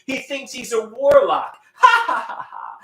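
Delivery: high mocking bully laugh and taunts; mocking bullies. Bullies laughing at him, high mocking jeers. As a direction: jeer like a playground bully. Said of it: "They say".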